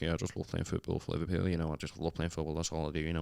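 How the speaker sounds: low, soft footballer impression; Steven Gerrard. Shown as a demonstration. Comedian showing his school talent show act, low footballer impression. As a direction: lightly put on a low, subdued footballer's voice. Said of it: "you just kind of talk really slowly".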